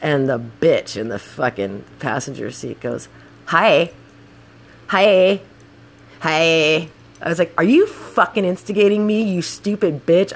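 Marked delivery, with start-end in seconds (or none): 3.5-6.9 imitated needling greeting; mock taunting hi. Passenger goading the scooter rider, mock taunting. Passenger provoking her, acted. 7.6-10.3 acting out an angry challenge, pitched up; retold pissed-off. Scooter rider to taunting passenger, retold anger. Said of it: "I was so pissed".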